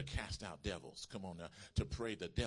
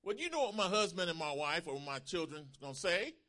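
raised, high-pitched protest; shouted excuse; 8 semitones higher, 7 dB louder than usual. Acted. People shout objections out to God, raised voice. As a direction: call it out as an objection. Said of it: "what do people shout out to God".

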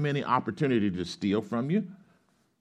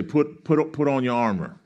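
call for repetition; 5 semitones higher, 4 dB louder than usual. Real, not acted. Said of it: "Everybody say".

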